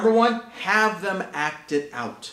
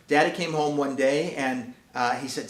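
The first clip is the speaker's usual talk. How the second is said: normal talking voice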